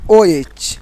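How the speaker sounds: after a pause, ओ एच said on its own; stop, then say ओ एच separately. Shown as a demonstration. Teacher to students, after a clear break: ओ एच. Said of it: "फिर रुकना है".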